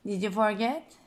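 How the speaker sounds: usual speaking voice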